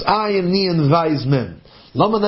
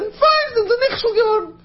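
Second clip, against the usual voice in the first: much higher, crying lament; wailing cry. Acted. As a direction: let the line break into a wail.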